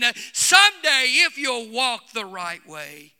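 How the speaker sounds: loud, driving preacher delivery; full-force preaching. Real, not acted. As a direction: exhort the congregation with mounting fervor.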